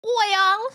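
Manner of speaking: "Oil." said high and drawn out; mock "oil". Shown as a demonstration. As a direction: show off the drawled "Oil.". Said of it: "who pronounces it the right way".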